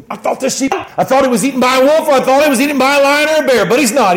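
high, excited outburst; overjoyed shepherd. Acted. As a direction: blurt out the relief at finding your sheep. Acting the shepherd calling friends to rejoice.

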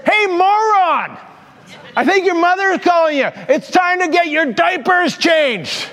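high, mocking schoolyard taunt; retold taunting yell. Boy jeering at the bully across the street, mock yelling taunt. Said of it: "I yelled at him".

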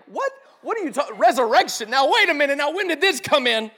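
high, loud bewildered outburst; mock alarm, voice jumping up; acted incredulous reaction. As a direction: burst in with baffled objections. Acted baffled reaction to the verse.

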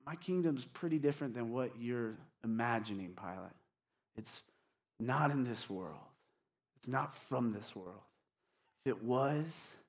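soft, low, unruffled reply; calm, unhurt. Acted. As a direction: gently answer the accuser, voice kept down. Voices Jesus calmly answering Pilate.

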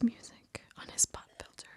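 breathy whisper into the mic; ASMR whisper. Pretend. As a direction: whisper close like an ASMR video. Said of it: "A S M R".

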